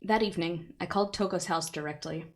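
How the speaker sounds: usual speaking voice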